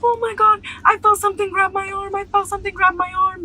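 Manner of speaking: high, panicked mock sobbing; imitating crying boy. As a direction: play the terrified boy wailing. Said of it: "bawling his eyes out".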